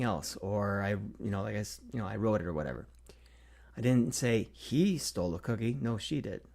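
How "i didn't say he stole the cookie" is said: whispered, he stressed with a rise. Shown as a demonstration. Teacher demonstrating stress on he in a whisper. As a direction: lift and lean on he. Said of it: "inflect each word in that sentence".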